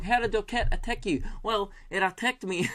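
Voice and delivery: high mock Kiwi radio host; New Zealand accent. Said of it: "I've got it now".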